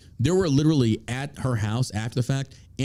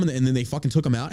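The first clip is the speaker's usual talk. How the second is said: usual speaking voice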